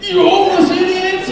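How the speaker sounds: high, loud hammed-up snarl; mock angry insult. Imagined look of his toss, a hammed-up high loud snarl. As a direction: fling it like a cartoon villain. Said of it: "like I just went".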